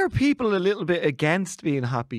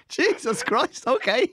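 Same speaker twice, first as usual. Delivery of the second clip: laughing disbelief; 8 semitones higher, much slower than usual. Real, not acted. Laughing reaction, high.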